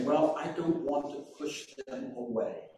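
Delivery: hushed, hesitant mock excuse; imitating hesitant Christians in a quiet voice; timid excuse. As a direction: mimic a timid believer backing off. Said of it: "dance around this and say".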